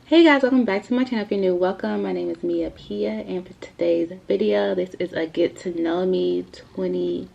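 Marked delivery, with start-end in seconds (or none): none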